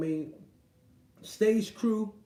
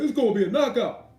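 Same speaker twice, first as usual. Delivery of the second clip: high, louder mock commentator voice; 3 semitones higher, 6 dB louder than usual. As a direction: parody the pundit's empty prediction. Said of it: "Porter saying some".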